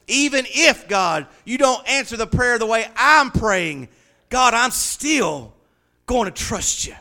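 loud, high, driving sermon voice; rousing preacher's cry of praise; full-force preaching. Acted. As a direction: preach it to the back row.

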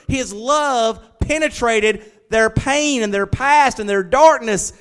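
impassioned preaching. Real, not acted. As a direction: preach it out high and loud, driving each phrase home. Preaches at full force.

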